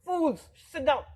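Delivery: high, slow scolding; scolding swami. Retold or imitated. Swami scolding novices who ran out, high and slow. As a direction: scold them in the old man's squeaky voice. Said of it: "he had a high-pitched voice".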